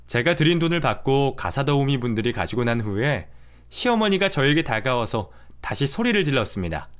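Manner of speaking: usual speaking voice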